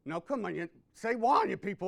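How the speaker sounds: ordinary talk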